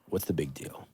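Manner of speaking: whispered, careless; whispered shrug. Retold or imitated. Telling himself three sales are nothing, whispered. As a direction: breathe it off like it doesn't matter.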